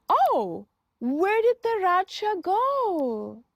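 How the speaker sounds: normal talking voice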